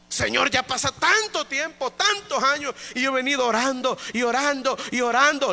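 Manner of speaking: high, pleading plea to the Lord; pleading prayer. Retold or imitated. Retold prayer to God. (ruego cansado a Dios, voz más aguda)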